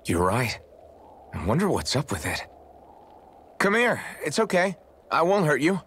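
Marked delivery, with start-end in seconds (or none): 3.6-5.8 high-pitched, reassuring coax; gentle coaxing. Acted. Companion to a frightened owl, gentle coaxing. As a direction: coax a scared animal closer.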